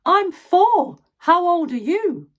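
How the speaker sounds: put-on high, loud, cheeky little boy voice; cheeky four-year-old. Little brother cheekily answers the grannies, high and loud. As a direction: pipe up like a bold wee boy. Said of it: "he'd immediately say".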